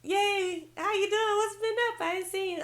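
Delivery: high, excited retold squeal; happy greeting. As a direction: greet a long-lost cousin way up high. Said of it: "I was really happy to see him".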